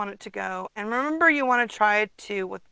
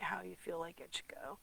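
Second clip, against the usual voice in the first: whisper; 2 semitones lower, 16 dB quieter than usual. Real, not acted. Trailing aside.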